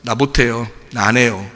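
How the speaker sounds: low, softened refusal; reluctant Moses. Retold or imitated. Moses telling God he won't go, reluctant. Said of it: "모세가 뭐라 그랬어요".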